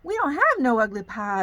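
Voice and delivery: put-on loud Southern-accented bear cubs; punchy storybook cubs, Southern accent. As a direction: call it out with a Southern twang. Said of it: "I get a Southern accent".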